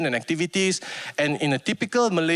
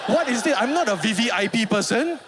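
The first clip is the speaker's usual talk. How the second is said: nervous panic; 4 semitones higher than usual. Retold or imitated. Panicked inner monologue.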